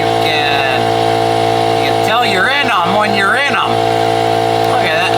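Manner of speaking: talking loud with headphones on; loud over noise. Real, not acted. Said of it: "I have headphones on and the compressor".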